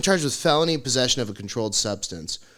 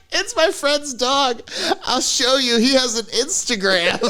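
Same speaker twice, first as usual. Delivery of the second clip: laughing explanation; 12 semitones higher, 4 dB louder, slower than usual. Retold or imitated. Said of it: "And I'm like".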